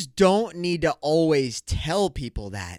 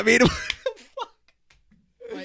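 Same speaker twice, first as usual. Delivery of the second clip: laughing it out, slow and higher; 8 semitones higher, much slower than usual. Real, not acted. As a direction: laugh through the words in disbelief. Laughs while saying it.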